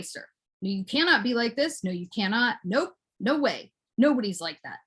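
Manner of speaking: ordinary talk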